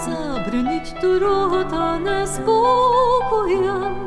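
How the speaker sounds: ordinary delivery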